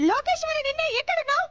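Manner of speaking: high, loud summons; angry calling out. Acted. Angry woman summoning Lokeshwari from inside the house, calling out furiously. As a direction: shout the name to summon her.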